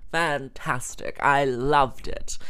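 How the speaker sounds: slow, drawn-out enthusiasm; stretched-out, gushing delivery; emphatic rave. Real, not acted. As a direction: relish every word of praise. Raving about the dim sum.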